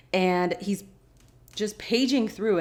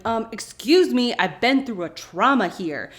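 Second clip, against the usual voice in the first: louder, mock-offended retort; hammed-up indignant protest; indignant comeback; 3 semitones higher, 5 dB louder than usual. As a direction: push it out loud and affronted. Acting Shannon's indignant comeback.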